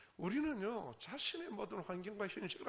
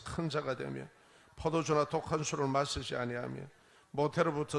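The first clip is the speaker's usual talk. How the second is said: scripture reading; 6 dB louder than usual. Acted. Reads scripture aloud with congregation.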